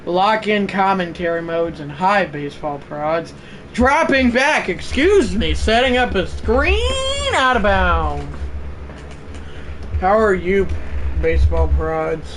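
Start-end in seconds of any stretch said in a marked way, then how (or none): 3.7-8.3 high, slow announcer call; play-by-play. Acted. Play-by-play commentary.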